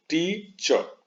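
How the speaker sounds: Teacher very slowly as टी, then चर; split into syllables. Shown as a demonstration. Teacher recapping two syllables, split and drawn out. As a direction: stretch टी high, then land on चर. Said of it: "दो बार में उच्चारण करते हैं".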